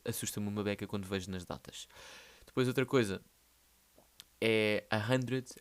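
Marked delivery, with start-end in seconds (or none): none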